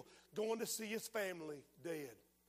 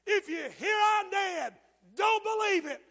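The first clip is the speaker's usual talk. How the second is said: high, loud, slow quoted shout; belting out the dead man's message; a man's last taped words, shouted high and loud; quoting the taped shout; 9 semitones higher, 9 dB louder than usual. Retold or imitated. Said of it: "tape of him standing up and said".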